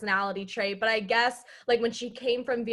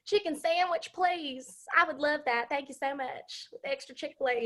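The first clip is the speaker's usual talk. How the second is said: pitched-up impression of mom's Southern twang; mom's Southern accent; 5 semitones higher than usual. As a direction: order like a gracious Southern mama, drawling. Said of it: "every time she goes to Chick-fil-A".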